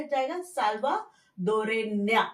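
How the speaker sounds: साल्वाडोरेña drawn out very slowly, ending nya; slow careful साल्वाडोरेña; feminine form. Shown as a demonstration. Teacher models feminine nationality, very slow. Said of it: "female के लिए".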